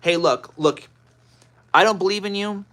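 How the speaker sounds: hammed-up know-it-all, loud and pushy; mock arrogant debater. Streamer mocking a debater who talks down to people, loud sneer. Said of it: "It was".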